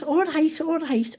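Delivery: high-pitched, hurried-off acknowledgement; calling back. Retold or imitated. Nan answering granddaughter's shouts, high calling back.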